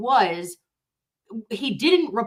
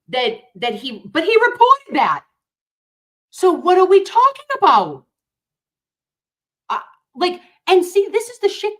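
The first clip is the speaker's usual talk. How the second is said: suddenly much higher and louder, exasperated; worked up; 10 semitones higher, 6 dB louder than usual. Real, not acted. Vlogger venting to her viewers, worked-up outburst. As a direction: burst out in real frustration. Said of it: "I'm a little bit worked up".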